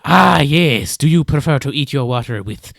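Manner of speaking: loud, drawn-out creature question; lizard man. Acted. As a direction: relish it grandly, booming the last word. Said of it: "Hey, lizard man".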